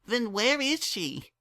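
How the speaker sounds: a daughter talking her father round; young filly Dinky. A narrator's reading. Dinky asks about mom.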